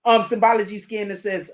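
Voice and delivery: usual speaking voice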